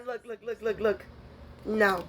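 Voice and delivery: usual speaking voice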